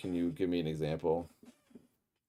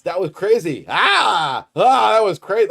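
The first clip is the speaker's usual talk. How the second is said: much higher and louder, the ah blared out; exaggerated loud ah; 12 semitones higher, 14 dB louder than usual. Shown as a demonstration. Mocking a comment trend for chat, loud and exaggerated. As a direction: belt "Ah, ah" like an overblown reaction. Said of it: "I also don't think it means".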